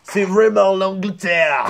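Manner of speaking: loud, slow, high English-accented French; mock English accent. Shown as a demonstration. Actor boasting of his English accent, hammed-up English accent. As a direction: put on a big, drawn-out English accent. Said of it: "J'arrive à bien faire l'accent anglais".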